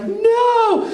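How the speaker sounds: a squeaky alarmed refusal; freaking out. Retold or imitated. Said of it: "And I'm like ... freaking out".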